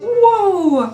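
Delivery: high, loud startled whoa; surprised whoa. Real, not acted. Reacting to intense colour.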